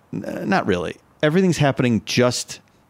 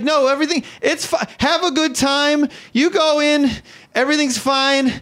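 much higher, over-bright it's fine; high frantic reassurance; 12 semitones higher than usual. Retold or imitated. To the group, high frantic fake cheer. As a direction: cover embarrassment with chirpy cheer. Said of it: "I'm just yelling stuff".

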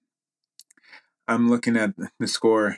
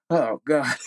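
higher retold near-sob, tipping into laughter; mock tearful; 5 semitones higher than usual. Athlete recalling crying after seeing a friend cry, mock tearful. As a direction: imitate welling up, then laugh at yourself. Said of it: "I started crying 'cause I saw my friend crying".

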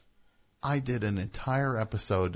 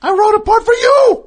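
shout; 16 dB louder than usual. Acted. Yelling the offer.

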